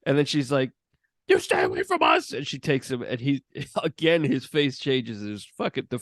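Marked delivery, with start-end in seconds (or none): 1.3-2.3 high mock warning; shrieking mother shielding her son; hammed-up high protective mother; frantic Wendy. Retold Wendy line.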